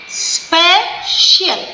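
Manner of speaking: Special slowly split into two syllables, spe... shial; two separate stresses. Shown as a demonstration. Teacher showing children a two-syllable word, special split slowly. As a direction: stretch special as two beats with a gap. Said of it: "दो बार stress होता".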